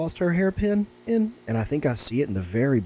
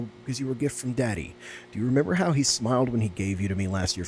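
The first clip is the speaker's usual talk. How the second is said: soft, lower letter reading; reading a sad letter; 4 semitones lower, 4 dB quieter than usual. Acted. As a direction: read the child's note gently, a touch sad. Reads diary note aloud.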